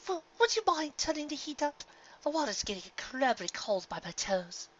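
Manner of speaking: lounging in a dream spa, languid and grand; Rarity asking the spa pony for a rub, pampered and high; posh Rarity. A narrator's reading.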